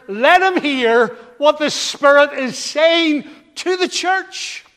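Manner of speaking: loud, high, drawn-out proclamation; preaching at full force. Real, not acted. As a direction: proclaim the scripture to the whole church.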